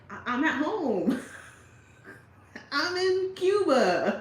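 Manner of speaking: loud, high-pitched protest; exasperated reply, rising to a drawn-out high cry; mock exasperation. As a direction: play an incredulous local protesting loudly. Acted baffled reply to the complainers.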